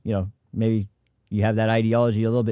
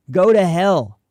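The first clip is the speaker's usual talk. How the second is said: louder, higher, real angry snap; angry outburst; 4 semitones higher, 6 dB louder, slower than usual. Real, not acted. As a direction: spit it out, fed up and furious. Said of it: "Sorry, I'm getting worked up".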